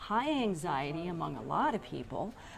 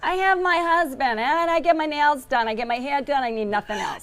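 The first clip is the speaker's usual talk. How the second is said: loud, high New York lady voice; hammed-up New York accent imitation; 6 semitones higher, 8 dB louder than usual. Repeats the woman's quote.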